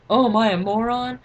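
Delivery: loud, high indignant question; mock-mad cousin. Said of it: "She's all mad".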